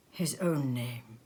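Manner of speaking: ordinary delivery, nothing marked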